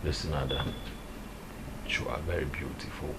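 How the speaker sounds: hushed, barely voiced; quiet whispered brush-off; soft whispered rejection. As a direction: let her down in a whisper. Man rejecting her softly.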